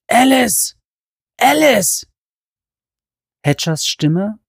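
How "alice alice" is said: high, loud desperate calling. Acted. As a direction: shout her name in panic. Hatcher calls for Alice.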